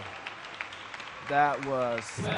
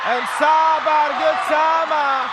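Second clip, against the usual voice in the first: excited shouting commentator; 8 semitones higher, 4 dB louder than usual. Real, not acted. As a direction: shout it like the winning point just landed. Match-winning point called live.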